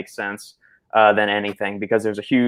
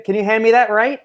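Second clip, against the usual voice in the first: higher voice, "right" tacked on with a questioning rise; questioning "right" tag; 11 semitones higher than usual. Retold or imitated. Mocking people's verbal tic, "right" rising as a question. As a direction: mimic the habit, lift "right" into a question. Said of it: "end every sentence with "right".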